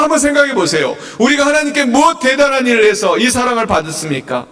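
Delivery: ordinary talk, nothing marked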